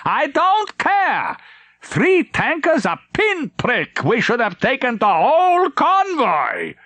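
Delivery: high, slow strained bark of fury; a livid admiral demanding answers; angry admiral. Acted. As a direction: admiral storms onto the bridge, high and slow. Admiral raging.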